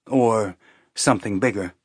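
ordinary delivery, nothing marked

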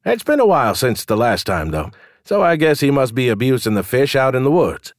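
gossiping tavern keeper, amused; barkeep voice. Acted.

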